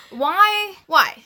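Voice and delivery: normal talking voice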